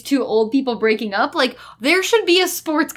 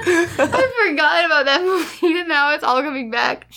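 slow, tearful giggly speech; real laughter running through the words; laughing; 3 semitones higher than usual. Real, not acted. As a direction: recover from a laughing fit while talking. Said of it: "You guys are still laughing about it".

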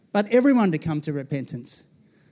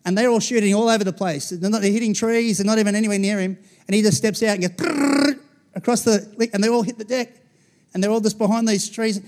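ordinary talk

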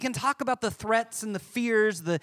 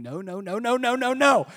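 put-on child's panicked no; 4 semitones higher, 6 dB louder than usual. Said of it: "her in the bathroom going".

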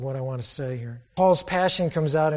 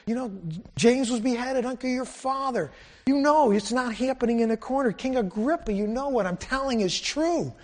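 higher, fervent preaching plea; preacher pleading with King Agrippa, higher; impassioned preaching; 7 semitones higher than usual. Acted. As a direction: press the king with rising fervor. Preacher voicing Paul's plea.

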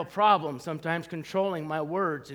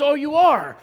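mock surprise; 7 semitones higher, 8 dB louder than usual. Salesman surprised by membership.

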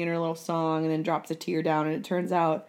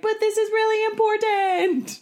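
high, loud, earnest plea; pleading insistence; 6 dB louder than usual. Retold or imitated. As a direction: insist it matters. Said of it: "And it's like".

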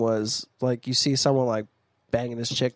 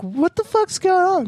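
put-on much higher, confused girlish voice; high mock woman voice; 4 dB louder than usual. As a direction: squeak it out, baffled. Voicing the woman in a joke.

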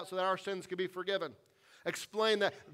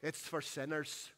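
quiet, lower Scottish pastor's voice; Scottish accent; 3 semitones lower, 7 dB quieter than usual. Retold or imitated. Old pastor reassuring a guilt-ridden woman, soft Scottish accent. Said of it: "through an incredible Scottish accent says".

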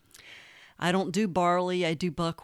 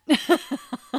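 burst of laughter; 7 semitones higher, 5 dB louder than usual. Real, not acted. Laughing at her own enthusiasm.

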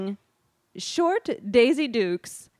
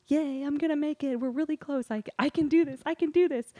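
higher, self pep talk under strain; hopeful cheer; 6 semitones higher than usual. Retold or imitated. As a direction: cheer yourself on to the finish. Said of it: "and I'm thinking".

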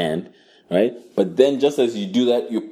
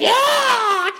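high shrieking war cry; mock battle yell. Woman attacking with the knife, high shrieking yell. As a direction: shriek it as she lunges. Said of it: "she's like".

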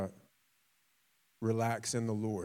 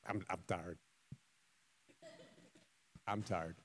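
much softer, drained I'm tired; worn-out player; 5 semitones lower, 12 dB quieter than usual. Retold or imitated. Exhausted player answering his coach, drained and soft. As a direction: sag like a player spent in the fourth quarter. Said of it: "And you're just like".

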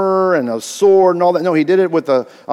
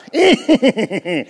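sinister laugh; 3 semitones higher than usual. Acted. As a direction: give it a sinister edge. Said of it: "Maybe it should have been a more sinister laugh".

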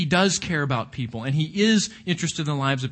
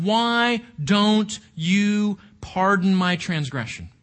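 slow, word-by-word plea as Job; crying out to God; 2 semitones higher, slower than usual. Acted. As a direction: draw out the dramatized appeal to God. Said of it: "he cries out to God".

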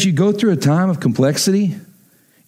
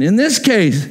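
ordinary talk, nothing marked

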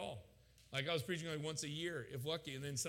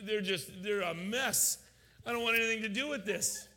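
loud, high-pitched exasperation; exasperated rant; 7 semitones higher, 7 dB louder than usual. Retold or imitated. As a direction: push it away in loud protest. Exasperated rant about church planters.

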